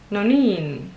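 nonin with -niin drawn out and falling; long second syllable. Shown as a demonstration. Learner trying the longer variant for viewers, drawn-out second syllable. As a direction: stretch the end of nonin. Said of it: "a bit longer in the second syllable".

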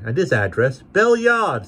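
put-on high, loud surly boy voice; hideous boy. A narrator's reading. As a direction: snap it like a rude lad. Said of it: "said the boy".

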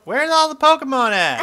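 high, loud playful wail; exclaiming. Pretend. Player searches an empty sea for Pokemon, high shout. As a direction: call it out in mock exasperation. Mock exasperated call in game.

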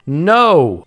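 loud, emphatic refusal; protesting cry. Real, not acted. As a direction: cry out against a sudden loss. Protests falling from great power.

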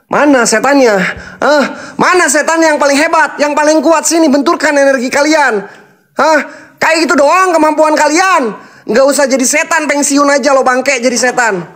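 high, mocking taunts flung at the spirits; taunting challenge. Real, not acted. Ghost hunter taunting the spirits in the hotel, high jeering challenge. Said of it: "menantang kalian dengan lantang".

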